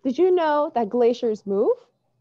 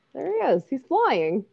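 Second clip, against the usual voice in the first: usual speaking voice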